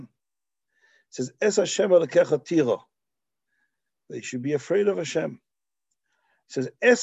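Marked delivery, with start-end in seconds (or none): none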